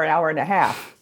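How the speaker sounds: normal talking voice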